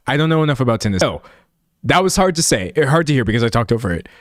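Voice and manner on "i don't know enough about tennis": ordinary talk, nothing marked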